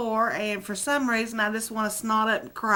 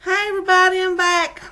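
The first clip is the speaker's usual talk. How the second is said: loud, high returning hello; calling out a greeting; 8 semitones higher, 9 dB louder than usual. Real, not acted. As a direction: call it out, announcing you're back.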